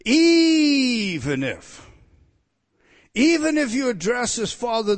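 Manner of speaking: even if drawn out, high and loud; emphatic reading. Shown as a demonstration. Preacher translating the Greek for the church, stressed. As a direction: stretch out Even if with weight. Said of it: "let me translate properly".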